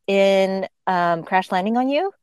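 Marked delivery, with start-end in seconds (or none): none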